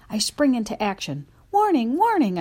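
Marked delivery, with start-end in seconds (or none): 1.5-2.4 high, loud alarm bark; alarmed shout. A narrator's reading. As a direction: sound the alarm, loudly. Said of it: "I bark".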